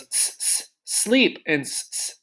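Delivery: s sounds stretched, then sleep; s, s, sleep taught slowly; hissed s sounds. Shown as a demonstration. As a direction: hiss the s, s before sleep. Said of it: "The S, remember, goes".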